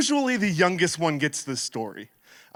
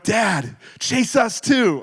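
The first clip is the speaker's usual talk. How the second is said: put-on higher, drawn-out pleading kid voice; kids begging; 5 semitones higher, 4 dB louder, much slower than usual. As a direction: whine it like a child asking to play. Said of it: "are like".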